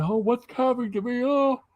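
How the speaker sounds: high, whiny fake despair, hammed up; mock distress. Mocking a rival's fake grief with a high, whiny wail. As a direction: wail it like a melodramatic fake mourner.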